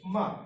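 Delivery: ma short, loud, falling from high; fourth tone. Shown as a demonstration. Teacher demonstrating the fourth tone, falling ma. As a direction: start ma high and drop it sharply. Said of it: "in the fourth tone".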